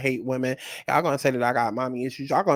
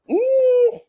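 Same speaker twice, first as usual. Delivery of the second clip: loud squealed ooh, very high; 6 dB louder than usual. Real, not acted. Teasing the next video with a loud squealed ooh. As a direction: squeal it like a siren.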